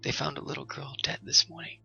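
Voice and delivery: hushed gossip whisper; woman whispering the news to the man. A narrator's reading. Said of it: "the woman whispered".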